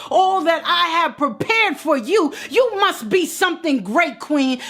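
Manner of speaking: high, ringing preacher's cry; preaching at full force. Acted. Preacher urging Queen to claim her worth, full force. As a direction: preach it up to the rafters.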